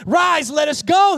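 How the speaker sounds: loud, high, drawn-out rallying shout; preacher's full-force rallying cry; exclamatory command. Acted. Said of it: "Exclamation mark".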